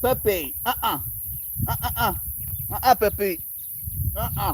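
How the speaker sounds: tut-tut a naughty critter; scolding a pet. Real, not acted. Streamer scolds an animal stealing from the trash, high playful warning.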